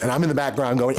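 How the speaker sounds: usual speaking voice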